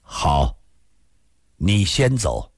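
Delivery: low, even, unhurried reply; cold, indifferent calm. A narrator's reading. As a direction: say it calmly and drily, voice dropped low. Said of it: "突又变得说不出的冷静平淡， 淡淡的说".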